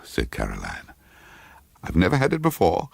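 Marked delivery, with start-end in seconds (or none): 1.8-2.9 anxious. A narrator's reading. Character names her vertigo.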